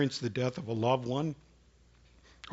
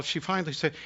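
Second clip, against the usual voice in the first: ordinary delivery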